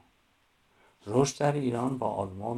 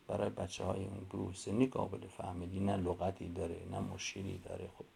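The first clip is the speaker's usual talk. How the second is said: ordinary delivery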